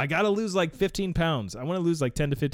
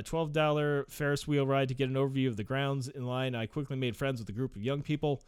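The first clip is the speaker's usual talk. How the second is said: usual speaking voice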